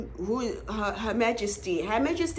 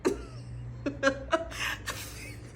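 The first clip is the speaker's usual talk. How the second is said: high airy giggle; 14 semitones higher, 7 dB quieter than usual. Real, not acted.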